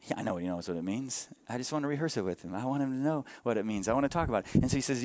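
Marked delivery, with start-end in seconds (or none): 0.1-3.9 low, soft, quick explanation; quiet aside. Real, not acted. Father explaining his bedtime question to the church, quiet aside. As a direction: drop the voice and slip in a quick aside.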